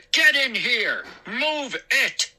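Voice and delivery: high, forceful orders; angry shout. Acted. McCall ordering son inside, angry shout. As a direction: order him indoors crossly. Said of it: "angry voice".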